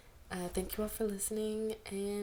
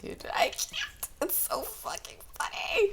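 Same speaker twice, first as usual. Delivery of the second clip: very high breathless whisper through laughter; wheezing laugh; 5 dB louder than usual. Real, not acted. Reader breaking down laughing mid-reading, whispered and high.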